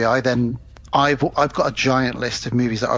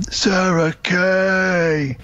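slow drawn-out zombie groan; 5 semitones higher, much slower than usual. Pretend. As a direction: moan the names like the undead. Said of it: "in my best zombie voice".

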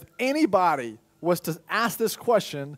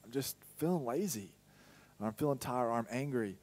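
lower, softer tired sigh-talk; weary mumble; 5 semitones lower, 8 dB quieter than usual. Acted. The heart answering the mind, low and weary. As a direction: sound too tired to care. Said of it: "And the heart's like".

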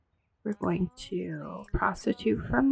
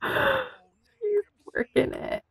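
high, slow amazed gasp into "She's working it"; excited gasp; much slower than usual. Real, not acted. Streamer watching her Sim work, amazed and delighted. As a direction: savour every word with delight.

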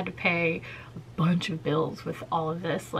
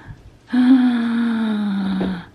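loud gasp; 4 semitones higher, 9 dB louder than usual. Real, not acted. Gasp at mirror dimming.

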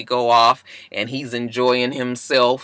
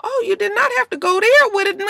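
shocked. Retold or imitated. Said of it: "I said".

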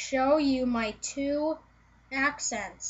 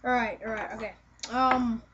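usual speaking voice

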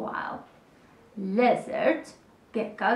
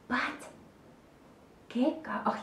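ordinary delivery

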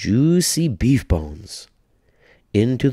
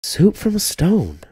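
put-on very high, slow, amazed old lady; old woman's high amazement; little old lady, marvelling; 13 semitones higher than usual. A narrator's reading. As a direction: gasp it out in wonder. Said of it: "said the little old lady".

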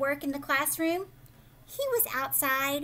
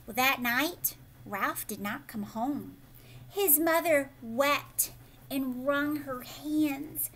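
usual speaking voice

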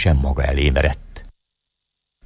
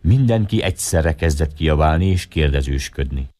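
ordinary delivery, nothing marked

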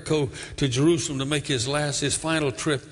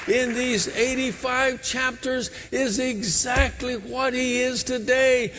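higher, rising preacher's fervour; full preaching; 7 semitones higher than usual. Acted. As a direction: preach it to the back row. Preaching climax.

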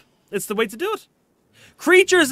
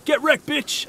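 higher pitched mock taunt; mock screaming streamer; 9 semitones higher, slower than usual. Mocking screaming streamers, higher-pitched taunt. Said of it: "screaming down the mic going".

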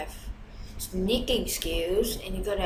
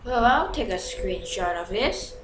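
normal talking voice

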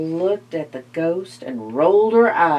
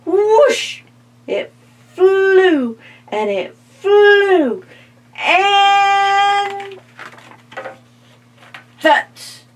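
excited narration; 11 semitones higher, 5 dB louder, much slower than usual. A narrator's reading. Pumpkin flying through the air.

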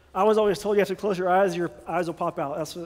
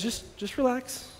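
softer, slow soothing reassurance; calm pastor; 4 semitones higher, 6 dB quieter, slower than usual. Retold or imitated. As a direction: settle them down gently. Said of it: "he's very wise and he says".

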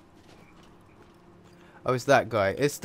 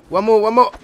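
much higher, louder eager cry; excited urging; 9 semitones higher, 14 dB louder than usual. Real, not acted. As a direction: urge it on, almost there. Urging final kill.

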